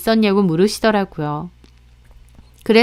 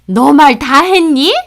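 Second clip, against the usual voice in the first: loud, slow, higher-pitched anger; indignant challenge; 5 semitones higher, 7 dB louder, slower than usual. Acted. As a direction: challenge her as the angry mother-in-law. Mother-in-law confronts her.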